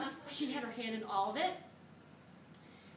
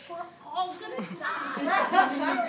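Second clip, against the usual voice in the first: slow, drawn-out, laughing wail of doom; laughing mock doom; 3 semitones higher, 7 dB louder, much slower than usual. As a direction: stretch it out like a laughing death sentence.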